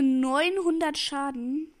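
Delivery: "neunhundert" drawn out and loud; stress on neunhundert. Shown as a demonstration. Gamer mocking the enemy Colt's damage, slow loud emphasis. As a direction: lean hard on "neunhundert", slowly. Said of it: "Ich betone".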